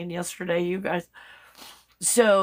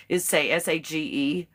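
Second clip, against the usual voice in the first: normal talking voice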